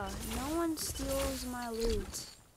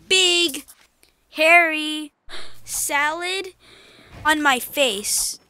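mock announcement; 4 semitones higher, 12 dB louder, slower than usual. Joking while using item.

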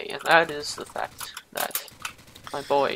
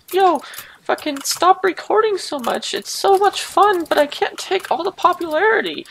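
much higher, louder whiny friend voice; mocking friends; 13 semitones higher, 7 dB louder than usual. Friends begging him to stop recording, whiny mock voice. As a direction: whine it like a jealous buddy. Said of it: "And they're like".

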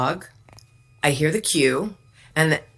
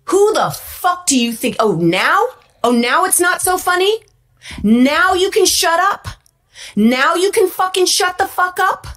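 Johnny Depp's rage; 6 semitones higher than usual. Retold or imitated. Star berating a young actress on set, building fury. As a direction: tear into her, rising to a high outraged pitch. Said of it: "he comes over and he goes".

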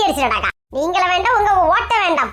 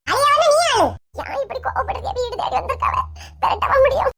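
ordinary talk, nothing marked

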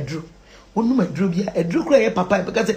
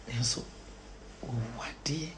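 very low, hushed aside; barely audible; whisper; 11 semitones lower, 20 dB quieter than usual. Real, not acted. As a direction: drop to a whisper. Drops to a whisper.

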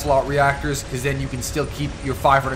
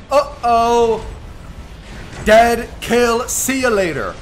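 gloating taunt; 6 semitones higher, slower than usual. Real, not acted. Gloating over kill.

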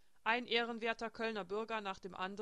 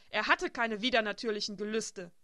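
louder, furious denial; 8 dB louder than usual. A narrator's reading. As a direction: defend him in anger. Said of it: "in eine wütende Furie verwandelt". (wütend und laut verteidigend)

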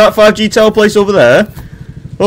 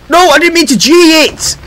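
high-pitched cry of dismay; alarmed outburst; 11 semitones higher, 4 dB louder than usual. Real, not acted. Gamer hit the wrong key, alarmed outburst. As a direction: blurt out a panicked protest.

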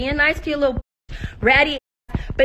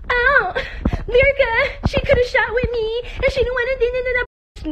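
high, whiny mock-tearful quote; mocking imitation; 10 semitones higher than usual. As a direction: mimic a rival's complaint in a high whine. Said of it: "talking about some".

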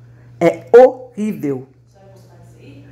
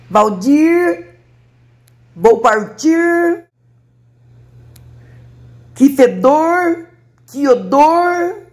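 slow, high, stretched r endings; drawn-out final r; 8 semitones higher, much slower than usual. Shown as a demonstration. Playful woman showing how words end in r, drawn out. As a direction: drag out every final r sound. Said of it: "Os verbos falam assim".

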